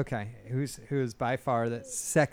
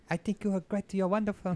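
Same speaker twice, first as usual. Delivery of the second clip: imitating Marcus; 5 semitones higher, 3 dB quieter than usual.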